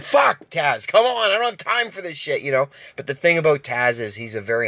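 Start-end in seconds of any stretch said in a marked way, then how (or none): 0.0-2.4 high, fed-up shout at the dog; frustrated yell. Retold or imitated. Owner yelling after his runaway dog, high and exasperated. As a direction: call the dog back, losing patience.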